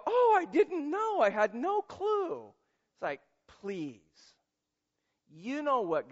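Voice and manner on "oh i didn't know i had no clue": much higher, louder fake innocence; hammed-up feigned ignorance, slowing down at the end; mock innocent. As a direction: whine it like you got caught. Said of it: "You're gonna go".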